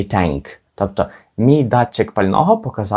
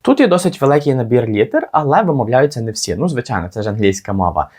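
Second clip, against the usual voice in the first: ordinary talk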